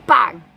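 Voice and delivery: loud door slam sound; loud bang effect. Acted. Door slammed in story.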